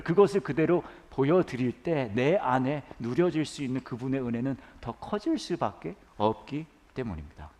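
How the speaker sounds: usual speaking voice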